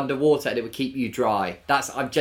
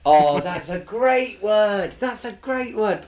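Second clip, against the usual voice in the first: delighted; 8 semitones higher, 5 dB louder, slower than usual. Real, not acted. Delighted by the new word.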